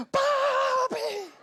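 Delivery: very high, drawn-out squealed call; child's yell. Retold or imitated. Son calling from across the house, screaming happily. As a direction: yell for Dad like an excited little boy. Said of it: "pero gritando".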